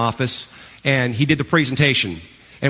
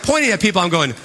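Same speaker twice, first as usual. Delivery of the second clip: normal talking voice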